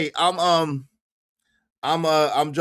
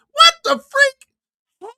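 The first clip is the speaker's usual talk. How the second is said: very high, loud, drawn-out squawk; comic high-pitched outburst; shocked disbelief; 6 dB louder, much slower than usual. Real, not acted. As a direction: stretch it out in a loud falsetto. Shocked reaction to the clip.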